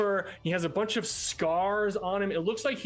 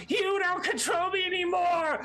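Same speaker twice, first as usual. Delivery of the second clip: defiant shout. Acted. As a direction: scream your freedom at him. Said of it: "he's like".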